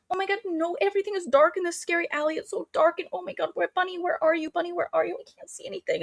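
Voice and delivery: very high, small whimpering voice; mock helpless panic. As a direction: imitate a lost girl calling for a friend. Acting Samantha lost in dark alley.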